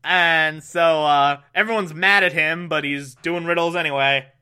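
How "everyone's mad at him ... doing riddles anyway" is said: usual speaking voice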